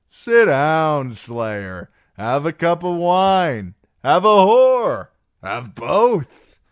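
loud, high, boisterous invitation; rowdy, drawn-out urging; expansive drunken singer. Acted. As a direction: bellow offers like a merry drunk. Plays Darion urging Sam to drink.

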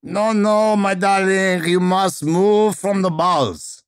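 high, theatrical Russian scolding; Madame Fedra, rich Russian accent. Acted. Teacher correcting a singled-out student, high rich Russian accent. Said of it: "speaking with rich Russian vowels".

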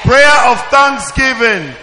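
loud call-out. Acted. Teacher calling out answer.

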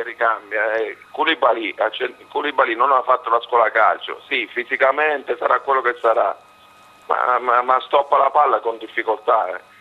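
ordinary talk, nothing marked

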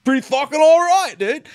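high, loud, fast cocky bravado; cocky survivor. Retold or imitated. Survivor boasting to the killer, high loud bravado. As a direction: brush off the crash like a tough guy. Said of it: "And then he's like".

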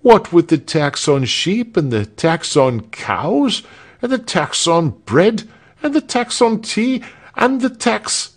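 high, insistent list of grievances; agitated complaint. A narrator's reading. Protester lists taxes until interrupted.

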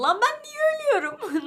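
shocked disbelief. Real, not acted. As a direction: screech it like you can't believe the comment. Reacts to comment telling her die. (çok ince sesle şaşkın isyan)

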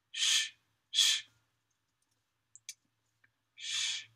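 drawn-out hushing sh; sh sound. Shown as a demonstration. Practising the letter that looks like a W, hissed sh. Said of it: "but it sounds like a".

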